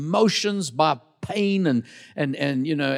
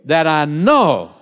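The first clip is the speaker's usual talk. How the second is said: congregation call-back, loud; 7 dB louder than usual. Shown as a demonstration. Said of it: "Say that".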